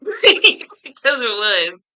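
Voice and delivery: loud real laughing through the words. Real, not acted. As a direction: crack up while talking. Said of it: "I'm laughing now".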